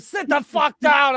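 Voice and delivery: hammed-up driver shouting orders; mock bus driver yell. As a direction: yell at rowdy passengers to sit. Bus driver yelling again.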